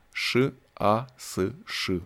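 each letter of «Ш А С Ш» read apart, very slowly; spelled out slowly. A narrator's reading. Reading the shirt pocket lettering, spelled out slowly.

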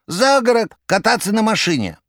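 high, rattling jester voice. A narrator's reading. Koroviev on phone.

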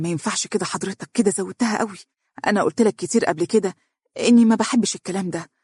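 put-on anxious girl protesting on the phone; nervous. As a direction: rush the words out anxiously. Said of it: "تقول بتوتر".

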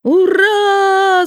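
high, drawn-out hurrah; children's cheer. A narrator's reading. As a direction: cheer long like a crowd of kids. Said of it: "закричали все дети".

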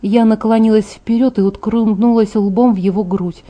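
usual speaking voice

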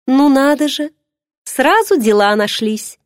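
high, mocking inner sneer; mocking the servants who suddenly look busy; sarcastic thought. A narrator's reading. Heroine mocking the servants as they scatter, sarcastic. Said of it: "язвительно подумала я".

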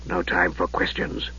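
low, soft, sinister mage; Raistlin's voice. Acted. Raistlin greeting his nephew, low and softly sinister. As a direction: hush it like a frail dark wizard.

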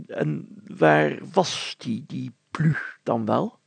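slow, careful question; drawing the answer out of her; probing mayor. Acted. Mayor slowly asking the girl where it was.

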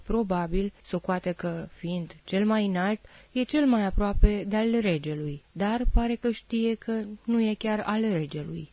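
plain reading voice

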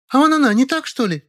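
very high shrill accusation; squealing jab. A narrator's reading. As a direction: squeal and point. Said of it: "заверещала Зайка". (визгливое обвинение)

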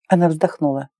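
plain reading voice

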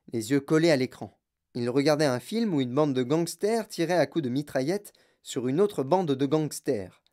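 usual speaking voice

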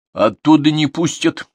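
decisive. Acted. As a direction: cut her off decisively. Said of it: "решительно возразил внук".

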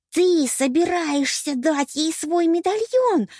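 high, drawn-out outraged squeal; shrieked accusation. A narrator's reading. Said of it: "взвизгнула Пенелопа". (возмущённый визг)

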